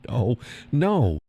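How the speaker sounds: ordinary delivery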